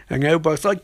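normal talking voice

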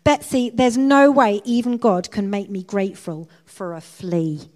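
loud, emphatic protest; exasperated interruption. A narrator's reading. Corrie cuts in on her sister's prayer, exasperated. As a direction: cut in loudly, refusing to go along. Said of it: "She cut in on her sister".

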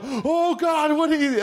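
high-pitched, overdone dismay; mock horror. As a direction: cry out in hammed-up shock. Acting out shocked puppet bit.

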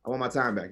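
normal talking voice

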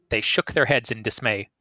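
usual speaking voice

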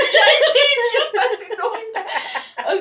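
high, loud laughing self-scolding; laughing at herself. Retold or imitated. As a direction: laugh it out, cringing at yourself. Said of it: "Y yo".

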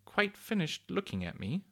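high, lightly cooing cuckoo; a hint of a cooing lady cuckoo; soft cuckooing voice. A narrator's reading. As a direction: lift it into a gentle bird-like coo. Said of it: "she asked in a soft, cuckooing sort of voice".

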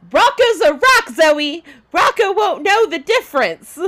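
high, loud exasperated outburst; hammed-up indignant protest; Elmo losing his mind. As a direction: yell it like you've had enough of this nonsense. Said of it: "And Elmo loses his mind".

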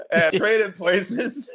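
laughing out the title, slowly and high. Real, not acted. Host laughing to his co-host while naming a favourite movie, laughing. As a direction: chuckle through the words, drawn out.

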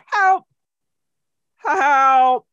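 high, loud cry for help; calling for help. Retold or imitated. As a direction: call out trapped and desperate. Trapped Tom Hanks calls for help.

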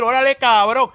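loud, high mock challenge; play-acted confrontational shout; mock Mexican challenger. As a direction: call out like an imitated Mexican tough. Acting Mexican group confronting the racist.

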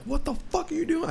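very high, furious "what the fuck"; high-pitched retold outburst; pissed off. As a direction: curse him out. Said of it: "I was pissed".

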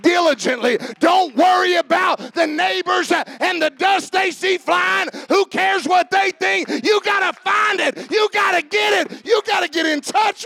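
loud, high rallying cry; rally them to action; preaching at full force. Acted. Preacher urging the church on, shouting.

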